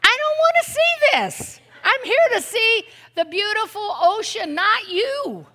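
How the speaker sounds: retold exasperation at the selfie girl; annoyed. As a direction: huff it out, fed up with the posing girl. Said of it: "I'm like".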